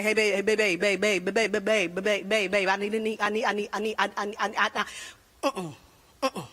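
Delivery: low, stuck repeats of "babe" and "I need"; exaggerated stutter that never gets past "I". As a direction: imitate a husband jammed on "babe", then "I". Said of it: "My husband stutters".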